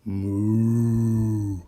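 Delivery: a hint of a mooing cow, lips together; showing children how to moo like the cow in the book. Shown as a demonstration. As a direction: show the kids how to moo. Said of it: "put your lips together and go".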